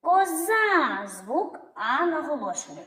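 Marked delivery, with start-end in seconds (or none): 0.0-1.1 "Коза" called high, ко-ЗААА; calling out. Shown as a demonstration. Teacher shows which vowel stretches, high calling "коЗАА". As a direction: stretch the final А of "Коза" as a call. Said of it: "Який тягнеться?".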